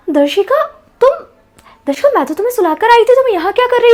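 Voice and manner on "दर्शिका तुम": startled gasp. Acted. Mother caught by surprise.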